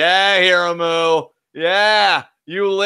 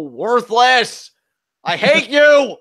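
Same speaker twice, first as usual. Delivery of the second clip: slow then loud and high; angry outburst; 6 semitones higher, 6 dB louder, much slower than usual. Real, not acted. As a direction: let the hate boil over. Venting at the screen.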